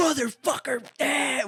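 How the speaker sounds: high-pitched mock scream, held back in volume; imitated screaming. Kid overhearing the store robbery, mock screaming. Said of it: "like, screaming".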